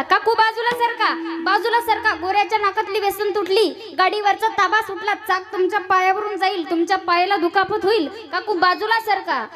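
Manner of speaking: calling out from afar. Retold or imitated. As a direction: warn the aunt from a distance. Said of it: "लांबूनच ओरडायला लागला".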